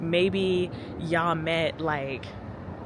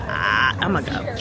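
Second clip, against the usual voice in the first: low, drawn-out awkward aside; awkward retreat; 8 semitones lower, slower than usual. Retold or imitated. Retelling her exit from the laughing men, awkward and low. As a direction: draw it out low, backing away.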